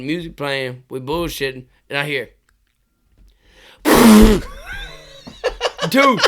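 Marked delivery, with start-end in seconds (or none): 3.8-6.2 words breaking up with real laughter, louder and higher; laughing hard. Real, not acted. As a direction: laugh through the telling, slowly. Laughing while telling the story.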